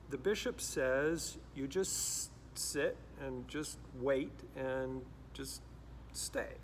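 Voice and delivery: normal talking voice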